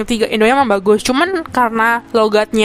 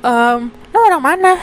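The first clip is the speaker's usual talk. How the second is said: slow, higher-pitched judgy question; judgmental questioning; 4 semitones higher, slower than usual. Retold or imitated. Taiwanese local asking where you are from, mock judgmental. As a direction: mimic someone pointedly quizzing an outsider. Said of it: "kalian kebayang lah ya dari nada gue".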